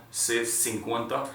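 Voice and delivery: "se cincuenta" said slowly with s sounds; slow Spanish demo. Shown as a demonstration. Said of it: "You hear that sound".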